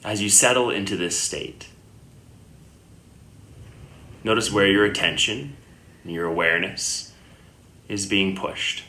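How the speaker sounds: unhurried meditation guidance with long pauses; guided meditation. Acted. Yoga teacher guiding listeners lying down into Yoga Nidra, calm meditation voice. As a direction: settle the listener gently, leaving long silences between phrases.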